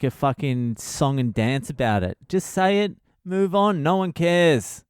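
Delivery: ordinary talk, nothing marked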